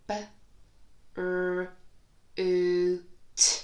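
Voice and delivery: F, l, oo, t each said alone with a loud oo; sound-by-sound. Shown as a demonstration. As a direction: tap out each sound on a finger. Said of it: "Let me do it with you".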